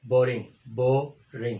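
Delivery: Boring stretched with a pause between syllables; syllables broken apart. Shown as a demonstration. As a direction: break boring into bo then ring. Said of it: "टू syllable adjective".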